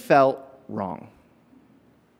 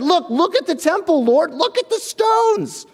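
awestruck apostles; 13 semitones higher than usual. Retold or imitated. Apostles marvelling at the temple to Jesus, high and awestruck. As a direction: gape up at the temple stones. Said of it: "The apostles said".

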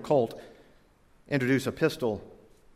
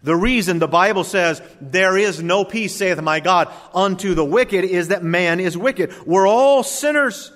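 rising, louder preacher's cadence; preaching; 5 semitones higher, 6 dB louder than usual. Acted. Preacher building his point to the congregation, loud sermon cadence. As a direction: preach it out with force.

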